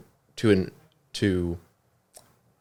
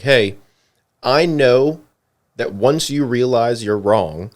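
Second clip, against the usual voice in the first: mock-encouraging, climbing higher and louder; playfully sarcastic quote, pitched up and emphatic; almost sarcastic; 5 semitones higher, 8 dB louder, slower than usual. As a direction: voice a letter writer's knowing nudge, building. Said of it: "it was kind of sarcastic almost".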